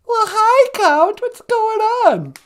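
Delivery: very high, loud and slow wife greeting. Acted.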